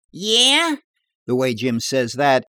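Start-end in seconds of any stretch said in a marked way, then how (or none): 0.1-0.8 high-pitched, rising credulous question; wide-eyed, much higher and louder; almost believing. A narrator's reading. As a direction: squeak it out as if half convinced. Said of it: "he could almost be believing it".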